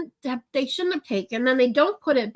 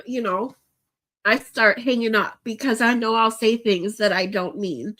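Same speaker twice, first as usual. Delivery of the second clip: ordinary talk, nothing marked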